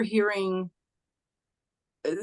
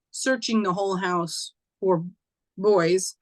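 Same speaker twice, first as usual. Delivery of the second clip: usual speaking voice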